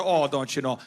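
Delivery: low folksy Minnesotan voice; Minnesotan accent. Acted. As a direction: drop low into a mock Minnesotan catchphrase. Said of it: "you move to Minnesota".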